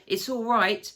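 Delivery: high, loud joyful shout. A narrator's reading. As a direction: call out to reassure everyone in the cave. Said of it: "he was shouting".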